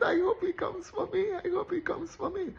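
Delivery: high, pleading schoolgirl wish; dreamy hopeful girl. Retold or imitated. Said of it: "every girl is dreaming in their mind".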